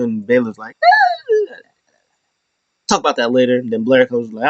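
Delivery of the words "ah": very high mock squeal; imitated squeal. Retelling a wrestler's backstage reaction, high squeal.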